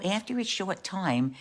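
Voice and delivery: usual speaking voice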